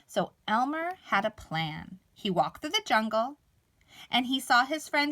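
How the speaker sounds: ordinary delivery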